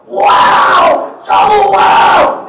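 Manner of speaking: hammed-up sports announcer going wild; Rick Jeannoret. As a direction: yell it like a hockey goal call. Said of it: "Rick Jeannoret's real big around here".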